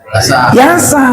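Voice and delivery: Yasa read as one merged word, wrong; mock wrong read of Yasa; student's mistaken reading. Shown as a demonstration. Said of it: "Kok dibaca sin?".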